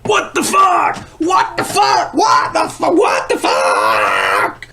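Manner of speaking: high repeated shrieks, the last one long and drawn out; panicked screaming. Acted. Narrator sees who is under the sheet, shrieking in panic. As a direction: scream it over and over in shock. Sheet falls, revealing his friend.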